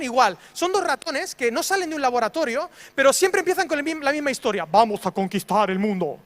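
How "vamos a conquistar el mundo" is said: Cerebro voice. Retold or imitated. Preacher mimicking Cerebro's plan to Pinky, low scheming voice. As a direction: lower scheming cartoon mouse. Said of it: "¿cómo empiezan Pinky y Cerebro?".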